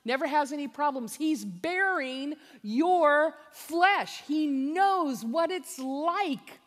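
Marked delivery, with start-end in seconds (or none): 1.1-6.6 slow, loud, high assurance; slow emphatic. Real, not acted. Preacher assuring them Christ understands, slow and emphatic. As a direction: lean on every word slowly.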